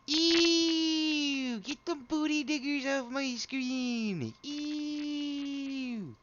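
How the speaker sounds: very high, drawn-out squeal of disgust; grossed-out high-pitched squeal. Pretend. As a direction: squeal it way up high, stretching the words. Mock disgust at gross picture.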